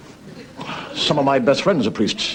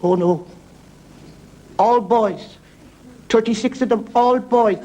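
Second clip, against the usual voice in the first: higher-pitched put-on woman's voice; lightly voiced Irish mother; 11 semitones higher, slower than usual. Irish mother answering the Pope, lightly acted higher voice. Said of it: "And she says".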